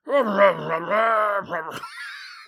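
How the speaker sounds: strangled yelping dog noise; a silly howl-bark, half laugh; muppety dog bark. Pretend. Co-host asked for the worst dog noise, howling bark. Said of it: "It sounds like a Muppet".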